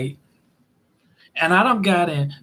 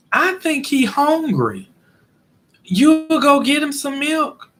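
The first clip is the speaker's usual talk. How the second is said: higher, slow bossy grandma voice; grandmother voice; 8 semitones higher, slower than usual. Retold or imitated. Grandmother telling Romeo to fetch milk, higher slow bossy voice. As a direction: tell him slowly from up high like grandma giving orders. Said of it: "she tell Romeo".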